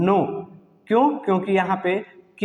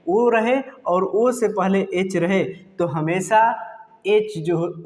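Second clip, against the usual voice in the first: normal talking voice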